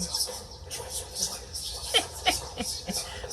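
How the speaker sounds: quiet, high giggling laugh. Real, not acted. Recapper amused by all the touching, soft laughter. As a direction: giggle softly for a while.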